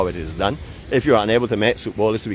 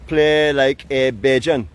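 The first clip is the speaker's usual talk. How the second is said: slow, drawn-out Barbadian accent, slightly raised; 4 semitones higher, 4 dB louder, much slower than usual. Shown as a demonstration. Man showing the dictator how to say it, Barbadian accent. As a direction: stretch it out in a proud Bajan accent. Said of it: "say it with a Barbadian accent".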